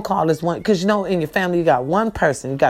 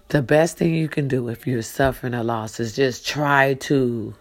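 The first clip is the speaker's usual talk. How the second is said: ordinary delivery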